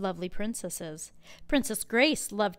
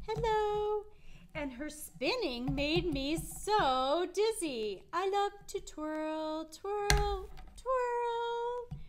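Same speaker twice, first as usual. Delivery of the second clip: high, slow sing-song princess voice; twirling princess; 9 semitones higher, much slower than usual. Pretend. Princess Grace greeting the prince while twirling, high sing-song. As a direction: play the dancing princess, drawn out.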